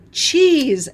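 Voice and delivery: loud, high gasped discovery; excited gasp then cheese. Retold or imitated. Crafter retelling her lunch idea, loud excited gasp. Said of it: "burst out laughing".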